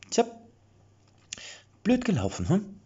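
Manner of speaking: high, sing-song fake sympathy; mock regret. A narrator's reading. Killer teasing the beaten mercenaries with high mock regret. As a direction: pretend to feel sorry. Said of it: "mit einem gespielten Bedauern".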